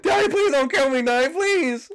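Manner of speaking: high, loud hammed-up pleading child; mock child begging. Caught by the animatronic, playing a begging child in high loud panic. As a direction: shriek like a child begging for mercy.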